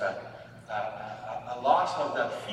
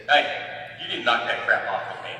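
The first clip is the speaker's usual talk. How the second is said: sudden loud scolding bark; retold angry yell; 8 dB louder than usual. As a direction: throw it at him like an angry older brother. Older brother scolding the therapist.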